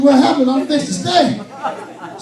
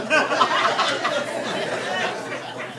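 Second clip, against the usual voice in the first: breathy laugh; 4 semitones higher, 7 dB quieter than usual. Real, not acted. Laughing at the call.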